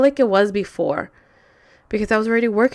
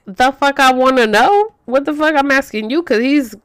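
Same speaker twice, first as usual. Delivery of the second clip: higher, louder-starting comeback; indignant retort; 4 semitones higher, 4 dB louder than usual. Retold or imitated. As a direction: throw the question back in outrage. Retold heated reply to boyfriend.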